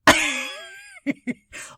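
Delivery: high-pitched embarrassed laugh. Real, not acted. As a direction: laugh it off sheepishly. Laughing after catching herself.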